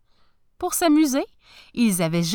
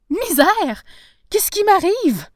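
high, loud exclamation of alarm; panicked outburst, pitched up; alarmed cry; 11 semitones higher, 6 dB louder than usual. A narrator's reading. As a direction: cry out in startled dismay. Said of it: "s'écria-t-il".

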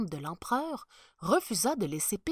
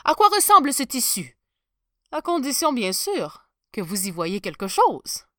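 higher, louder teasing command; sly emperor; 4 semitones higher, 11 dB louder than usual. Acted. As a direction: set the trap with a smirk. Emperor orders with a trap.

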